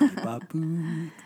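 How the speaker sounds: slow robot beeps. Pretend.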